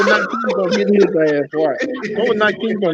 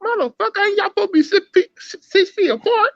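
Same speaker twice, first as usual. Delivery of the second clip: much higher, hammed-up scolding with a laugh; mock scolding yell; 11 semitones higher than usual. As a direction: yell at the mask-less henchmen in mock disbelief. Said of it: "I'm like".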